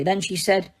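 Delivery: plain reading voice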